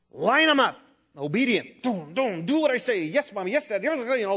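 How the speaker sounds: mock strict parent barking orders; a hint of a drill-sergeant mom; bossy parent. Preacher mimicking a parent drilling obedient children, mildly bossy.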